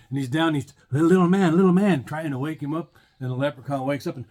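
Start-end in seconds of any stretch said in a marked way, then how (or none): 0.9-2.0 loud urgent call. Acted. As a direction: call out to wake him. Priest calls the leprechaun.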